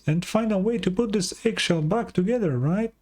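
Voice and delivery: chirpy little fairy sidekick voice; high Paimon voice. Acted. Streamer voicing Paimon asking the traveler, high and chirpy.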